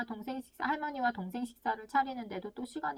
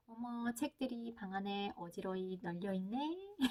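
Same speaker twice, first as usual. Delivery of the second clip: slow, softer, singled-out observation; describing without blame; 2 semitones lower, 6 dB quieter, slower than usual. Acted. Parent observes books strewn in room, studiedly non-blaming. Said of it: "그냥 일어난 사실만 묘사하세요".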